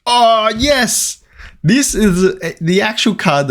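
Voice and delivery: high, drawn-out cry of delight; thrilled, high-pitched celebration. Real, not acted. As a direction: burst out with joy at a lucky find. Pulls card he was chasing.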